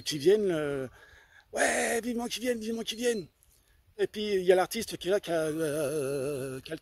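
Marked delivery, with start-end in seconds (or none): 1.5-3.3 eager crowd voice. Retold or imitated. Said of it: "que les gens, euh, ils en ont hâte".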